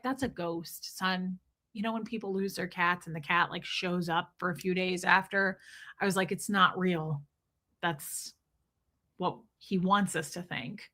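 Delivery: low, soft, conspiratorial delivery; mock-ominous hush. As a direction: drop low and quiet as if confiding something eerie. Mock-eerie theory that cat is ghost.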